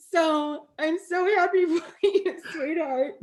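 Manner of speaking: words broken by happy laughter; laughing with joy. Real, not acted. Host sharing Naomi's joy, laughing. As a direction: giggle through the happiness.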